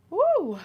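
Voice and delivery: high loud woo to shake off tears. Real, not acted. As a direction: cheer to break the emotion. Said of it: "getting emotional".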